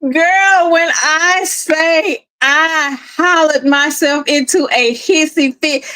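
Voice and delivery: ordinary talk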